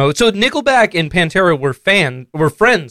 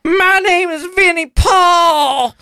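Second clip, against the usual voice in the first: put-on much higher, slow dopey man-child voice; Ralph voice; 11 semitones higher, slower than usual. Vinny as Ralph, asking his dad in a slow, high dopey voice. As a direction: ask it slowly, like a clueless slacker. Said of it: "my Ralph voice".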